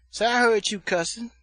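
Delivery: loud, high singsong accusation; singsong auntie. Retold or imitated. Aunt accusing nephew of cussing, loud mock singsong. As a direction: imitate the aunt singing out her charge. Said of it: "they all talk like they singing".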